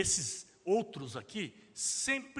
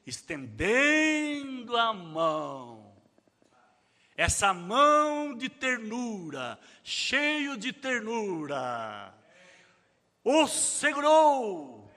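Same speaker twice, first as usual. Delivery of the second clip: tender, slow; 3 semitones higher, 6 dB louder, much slower than usual. Acted. Tender image of Jesus's hand.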